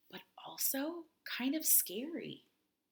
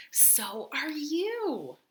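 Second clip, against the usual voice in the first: slow and higher, savouring it; slow storybook twist; slow drawn-out reveal; 6 semitones higher, 4 dB louder, much slower than usual. A narrator's reading. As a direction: stretch every word for the surprise. Unicorns tell Kelp the news.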